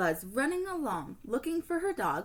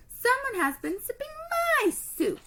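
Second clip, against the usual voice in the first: growl; 5 dB louder than usual. Acted. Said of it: "she growled".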